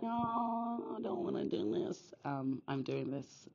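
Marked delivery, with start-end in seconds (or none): 0.0-2.1 a high "Oh" sliding into a whispered whine; mock reluctance. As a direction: play the reluctant servant, half-joking. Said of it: "as much as I'm like".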